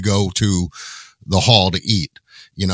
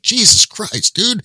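shocked exclamation; 14 semitones higher, 4 dB louder, slower than usual. Retold or imitated. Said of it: "I'm like".

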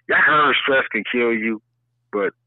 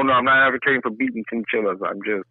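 normal talking voice

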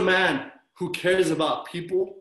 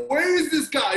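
high, loud mock panic; half shout it in hammed-up alarm; mock freak-out; 7 semitones higher, 5 dB louder than usual. Preacher imitates himself wanting a movie hero, mock freak-out. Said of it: "freaking out".

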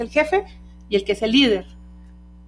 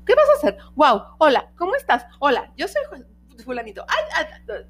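curious tester dog; 5 semitones higher than usual. Retold or imitated. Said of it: "me acerco con el otro".

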